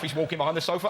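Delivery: usual speaking voice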